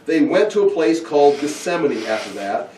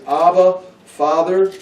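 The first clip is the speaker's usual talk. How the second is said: louder, higher cry to the Father; a raised, pleading prayer; anguished plea; 5 semitones higher, 5 dB louder, slower than usual. Acted. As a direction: call out in distress. Jesus praying in anguish.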